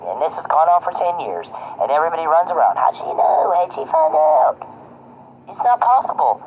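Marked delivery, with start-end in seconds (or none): 2.8-4.6 high, slow, squeaky mock alarm; mocking the flustered insiders in a squeaky voice; mock panic. Mocking everybody running around. 5.5-6.4 mock disbelief. Mocking their disbelief.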